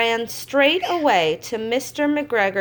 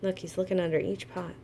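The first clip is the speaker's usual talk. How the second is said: quiet aside; 4 semitones lower, 8 dB quieter, faster than usual. Real, not acted. Aside while reading.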